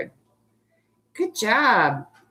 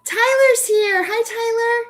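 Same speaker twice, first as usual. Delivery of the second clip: very high, sing-song welcome; high-pitched happy hello; delighted greeting; 13 semitones higher than usual. Real, not acted. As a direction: greet a late arrival in a high, drawn-out voice. Greets a pupil who just arrived.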